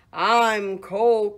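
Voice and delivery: loud, quacky complaint; quacking duck. Acted. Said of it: "quacked the duck".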